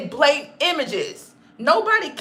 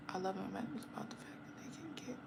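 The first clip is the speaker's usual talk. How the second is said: whisper; 5 semitones lower, 26 dB quieter, faster than usual. Real, not acted. Whispered aside.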